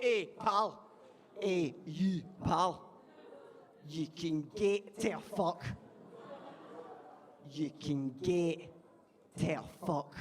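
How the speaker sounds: slow, menacing Scottish heckler impression; aggressive Scottish. Glasgow heckler threatens the comic, aggressive Scottish accent. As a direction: imitate an aggressive Scot, drawing the words out slowly. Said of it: "He was very aggressive and very Scottish".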